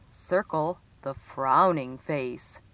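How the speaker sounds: usual speaking voice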